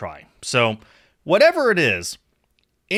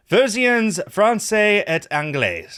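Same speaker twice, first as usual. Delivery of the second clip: French title read aloud; 7 semitones higher, 3 dB louder, slower than usual. Shown as a demonstration. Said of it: "It's French".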